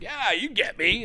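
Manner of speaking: lightly hammed-up pal voice; imitated agreeable guy. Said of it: "He's like".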